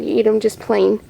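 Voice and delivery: ordinary talk, nothing marked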